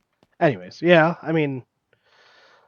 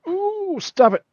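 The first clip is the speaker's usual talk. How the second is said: startled; slower than usual. Real, not acted. Nearly drove truck off.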